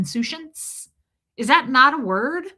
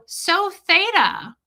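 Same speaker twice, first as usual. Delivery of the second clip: high, drawn-out, louder delighted reaction; mock gushing congratulation; 9 semitones higher, 5 dB louder, much slower than usual. Shown as a demonstration. Ex-member showing response to new job news, mock gushing. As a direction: gush over someone's good news, slowly and showily. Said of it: "you'd go".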